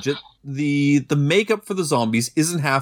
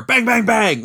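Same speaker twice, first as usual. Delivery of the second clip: higher, louder bang bang bang mimicking gunfire; imitated gunshots; 6 semitones higher, 3 dB louder than usual. Mocking the film's trigger-happy soldiers, imitated gunshots. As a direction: fire off three mouth gunshots.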